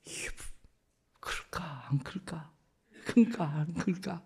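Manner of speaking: softly whispered worry; father whispering over his newborn; anxious whisper. Retold or imitated. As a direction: breathe the question as a secret fear. Anxious wondering over baby.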